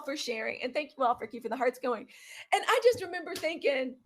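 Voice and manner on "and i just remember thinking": ordinary delivery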